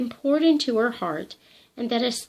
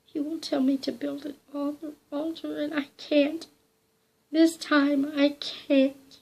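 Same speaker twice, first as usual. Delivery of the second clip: higher, trembling refusal; frightened refusal through chattering teeth; shuddering dread; 6 semitones higher than usual. A narrator's reading. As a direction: hold on to fear, slowing on the last words. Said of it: "through chattering teeth".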